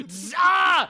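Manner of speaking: loud, high zap sound effect; shouted zap noise. Acted. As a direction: blurt a zap like a taser. Zapping sound for taser attack.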